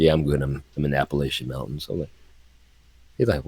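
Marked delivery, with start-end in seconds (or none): 0.0-1.8 low, soft, offhand Batman, lower and quieter; a hint of Batman, lower and calm; Batman unbothered. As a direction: shrug it off as if nothing happened. Acting Batman answering the phone.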